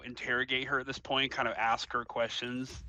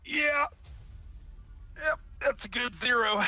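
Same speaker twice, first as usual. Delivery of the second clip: mock cheer; 11 semitones higher than usual. Real, not acted. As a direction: celebrate the failure ironically. Ironic cheer at failed roll.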